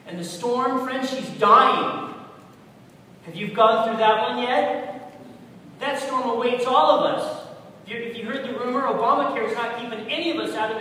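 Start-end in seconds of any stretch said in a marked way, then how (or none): 0.1-7.5 booming, slowed climax; loud preaching. Real, not acted. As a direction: thunder it at the congregation. Preacher's climax about death.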